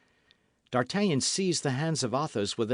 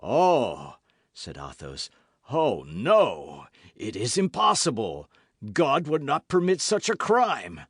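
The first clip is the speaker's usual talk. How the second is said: louder horrified denial; horrified Athos; 5 dB louder than usual. A narrator's reading. Athos realizing the poison, horrified. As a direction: disbelief breaking through.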